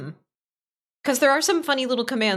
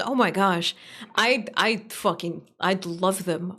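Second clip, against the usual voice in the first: ordinary delivery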